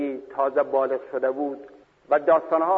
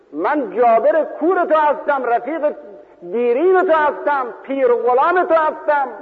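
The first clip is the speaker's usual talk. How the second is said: pleading wail; 10 semitones higher, 5 dB louder than usual. Retold or imitated. Jabir pleads at the grave.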